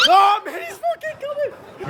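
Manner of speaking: high, loud laughing yell; panicked laughing shout. Real, not acted. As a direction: shriek in mock panic while laughing. Scared of robot, laughing.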